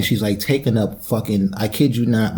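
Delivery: usual speaking voice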